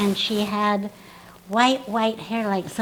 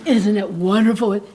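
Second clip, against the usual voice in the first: louder, rounded put-on voice; gushing in the new elocution voice; trained stage voice; 7 dB louder than usual. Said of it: "I was talking like this".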